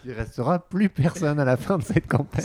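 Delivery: laughing through the words; amused, chuckling as he speaks. Real, not acted. Joking about staff being fired.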